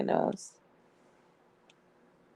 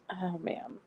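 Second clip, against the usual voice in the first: usual speaking voice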